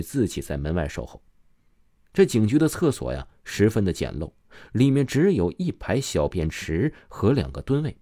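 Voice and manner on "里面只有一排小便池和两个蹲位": plain reading voice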